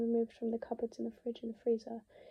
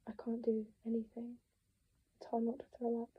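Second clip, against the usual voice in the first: usual speaking voice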